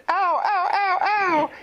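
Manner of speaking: high hurt yelps of a fish; pained cries. Acted. Pufferfish crying out on the beach, high pained yelps. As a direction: cry out in pain, high and loud. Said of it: "And she went".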